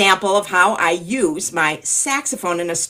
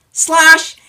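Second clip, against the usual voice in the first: big loud higher slosh; 10 semitones higher, 13 dB louder than usual. Shown as a demonstration. Storyteller leading children in splash word, loud. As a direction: make the splash huge. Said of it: "Can you do that with me?".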